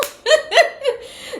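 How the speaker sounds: loud, very high laugh. Real, not acted. Reader amused by the imagined quarrel, high laughing. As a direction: crack up in a high burst.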